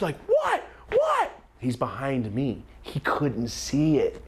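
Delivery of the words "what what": high-pitched yelped questions; imitated frantic cousin. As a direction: yelp it twice, desperate to know. Cousin frantically asks what happened.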